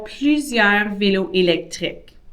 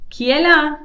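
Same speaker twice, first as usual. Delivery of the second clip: higher, louder woman's voice for the dialogue; female character voice; 8 semitones higher, 5 dB louder than usual. Acted. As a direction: play the woman at the fruit kiosk, pitched up. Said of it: "a different voice for the female character".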